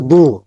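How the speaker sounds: "বু" as a heavy emphatic d; thick sound. Shown as a demonstration. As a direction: make the consonant of "বু" thick and full. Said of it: "মোটা sound হবে". ("বু" মোটা করে উচ্চারণ)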